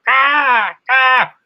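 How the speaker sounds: two loud, high squawks; imitated crow caw. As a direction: caw back like an old crow. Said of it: "The old crow would go".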